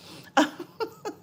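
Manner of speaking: short high-pitched giggle. Real, not acted. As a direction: let a bright giggle slip out. Laughing at chat.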